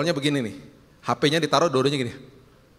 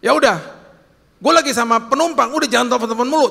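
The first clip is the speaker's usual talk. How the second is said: louder, higher curt brush-off into the phone; irritated driver; 5 semitones higher, 5 dB louder, faster than usual. Retold or imitated. Driver snapping at his wife on the phone, loud and irritated. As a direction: cut the caller off, annoyed and loud.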